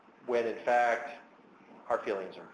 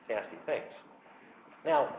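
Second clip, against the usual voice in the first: ordinary delivery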